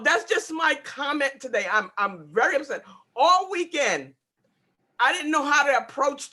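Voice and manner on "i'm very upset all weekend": ordinary delivery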